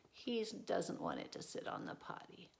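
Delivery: whispered aside. Acted. Reader confiding to the child about the monkey, whispered. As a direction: whisper it like a secret.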